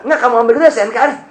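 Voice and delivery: fast, high, laughing policeman line; laughing cop. Retold or imitated. Officer telling him to fetch the papers, fast and laughing. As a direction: rattle off the officer's order through a laugh.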